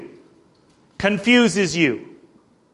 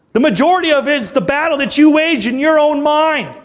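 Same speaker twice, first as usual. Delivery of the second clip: rally the congregation, full force; fired-up preaching; 7 semitones higher than usual. Real, not acted. Preacher firing up the church about opposition, rising fervor.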